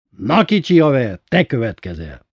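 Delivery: loud, high summons at the start, easing off; calling out. A narrator's reading. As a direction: call the kid over for his turn. Friend summons the boy.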